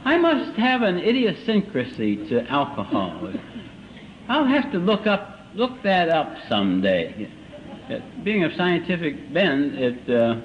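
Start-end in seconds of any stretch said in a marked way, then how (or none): 0.0-7.3 slow, over-deliberate pronouncement; acted slow, learned self-diagnosis; drunken musing. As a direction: reason it out ponderously, like a drunk scholar. Drunk man reasoning on the floor.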